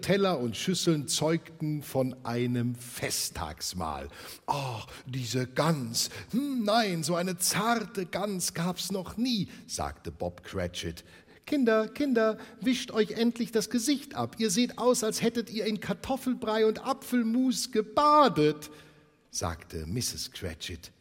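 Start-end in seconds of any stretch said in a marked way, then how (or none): 4.5-9.5 slow, delighted father savouring the goose; Bob Cratchit savouring. Acted. 11.5-18.7 put-on high, loud fond scolding of messy kids; a busy mother tutting at her children.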